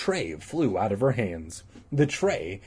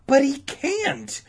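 much higher, slow sputtering protest; high shrill sputter; 3 dB louder, slower than usual. Acted. Todd sputtering in shock at the news, high protest. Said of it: "in a high, shrill voice".

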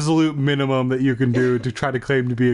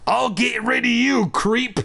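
put-on high-pitched villainous baby voice; cackly threat rising to a drawn-out shout; crackling monster baby; 10 semitones higher, slower than usual. Baby threatening the kid running from the room, high crackling villain voice. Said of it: "I heard the baby crackle".

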